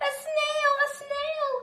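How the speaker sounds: high, loud shocked cry; teacher spotting a snail on the board, shocked and high. A narrator's reading. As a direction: shriek at the sight of it.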